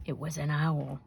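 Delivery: ordinary delivery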